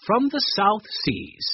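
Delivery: plain reading voice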